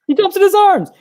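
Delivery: correcting the listener, pitching up sharply; excited insistence. Real, not acted. Telling his co-host what happens, excited high insistence.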